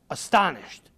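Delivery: ordinary delivery, nothing marked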